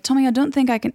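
high-pitched attempted Irish accent; Saoirse Ronan. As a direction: try a high Irish film-heroine voice. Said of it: "she was in the movie Brooklyn".

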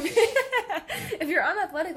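slow, laughing correction. Real, not acted. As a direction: laugh through the fixed word. Laughs correcting her slip.

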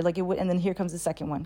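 usual speaking voice

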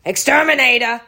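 hammed-up loud shout of a job title; exterminator's call. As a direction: call it out like a New York guy knocking on every door. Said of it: "That's literally what he sounds like".

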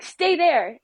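high, loud imitated order; urgent command. Ride staffer ordering mom by phone, mock urgent command. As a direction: imitate an official ordering someone to stay put.

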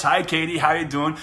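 ordinary talk, nothing marked

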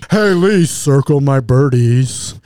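low, loud, slow mocking brag; smug taunt.

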